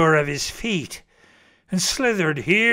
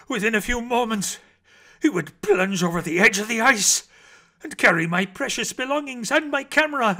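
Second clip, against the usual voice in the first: breathless excitement; 6 semitones higher than usual. A narrator's reading. Rising danger at the chasm.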